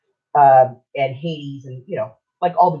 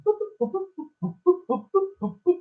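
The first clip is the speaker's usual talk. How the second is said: high little electronic-style boops; imitated phone dialing; 14 semitones higher, 5 dB quieter than usual. Son-in-law dialing Uncle Chris, imitated high phone beeps. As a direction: mimic keypad beeps with a high voice.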